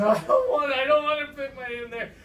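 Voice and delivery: high, worried protest; balk, pitched well up; retold scared refusal. Storyteller refuses to reach into the hole, high protest.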